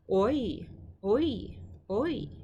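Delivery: OI with the pitch rising then falling; "oi" sung lightly up and down in one syllable; circumflex rise and fall. Shown as a demonstration. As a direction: swoop up and back down on "oi". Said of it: "Up and down".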